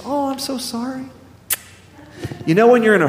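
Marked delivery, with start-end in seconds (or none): 0.0-1.0 put-on high, soft sorry from a sweet old lady; lightly voiced elderly cashier, gentle apology; apologetic old cashier. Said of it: "She goes".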